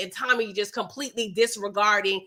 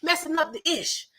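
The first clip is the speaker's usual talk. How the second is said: higher-pitched mock exasperation; fed-up inner grumble, acted out; imitated fuming; 5 semitones higher than usual. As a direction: fume as him without raising the volume. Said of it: "he fuming right now".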